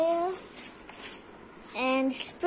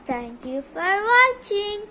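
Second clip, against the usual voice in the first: ordinary talk, nothing marked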